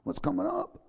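hushed mock wonder of the disciples; amazed disciples. Disciples listening to Jesus, a touch of hushed awe. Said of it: "they were sitting there listening, going".

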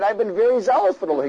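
acted protest, voice lifted high; high-pitched protest. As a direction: pitch it up, as a repeated excuse. Voices Elijah repeating his complaint.

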